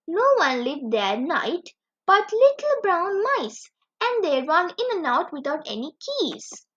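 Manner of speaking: ordinary delivery, nothing marked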